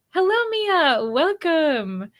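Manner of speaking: high, slow, sing-song hello; light up to welcome her in; excited greeting. Real, not acted. Greeting a newly arrived viewer, high sing-song welcome.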